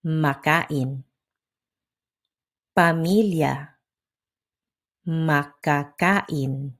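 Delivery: "Makain, Pamilya, Makakain" in measured, even syllables; natural Tagalog rhythm. Shown as a demonstration. Teacher modelling rhythm to copy, "Makain, Pamilya, Makakain" in even beats. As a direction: pace out each beat of "Makain, Pamilya, Makakain". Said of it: "Match the natural Tagalog rhythm".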